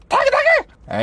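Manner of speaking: very high creature chirp; squeaky imp. Pretend. Player voicing the summoned imp, squeaky creature voice. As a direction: squeal the name like a little imp.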